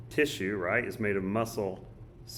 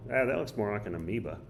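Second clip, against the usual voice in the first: usual speaking voice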